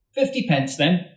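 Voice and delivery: lightly voiced Tomek. A narrator's reading. Tomek claiming his winnings.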